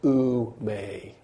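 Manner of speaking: ooh drawn out then may falling; Greek ou me. Shown as a demonstration. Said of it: "You need to be able to say".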